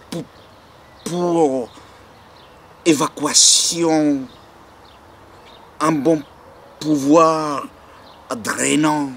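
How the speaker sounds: high, halting, emphatic recital; halting declamation. Acted. Reciter performing drainage advice from a catalogue, halting declamation. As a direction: stumble on "pour", then declaim each phrase high.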